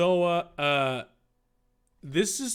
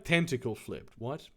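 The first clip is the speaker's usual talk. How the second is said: plain reading voice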